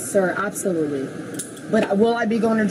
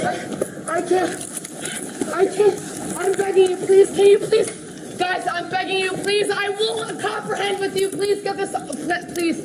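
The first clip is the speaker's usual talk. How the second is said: desperate begging; 9 semitones higher, slower than usual. Real, not acted. Woman under officers begging for release, tearful.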